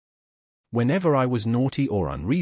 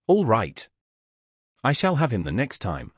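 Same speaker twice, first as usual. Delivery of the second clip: lightly voiced gruff bogeyman; a hint of a gruff voice from the chimney; gruff monster voice. A narrator's reading. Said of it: "a most terrible gruff voice".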